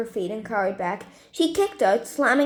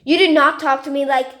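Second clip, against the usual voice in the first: loud, higher angry protest; 3 semitones higher, 8 dB louder than usual. Acted. Caller cut off by Stephanie, loud angry protest. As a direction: shout him down before she hangs up.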